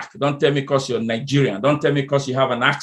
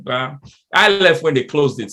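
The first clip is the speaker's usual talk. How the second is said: ordinary delivery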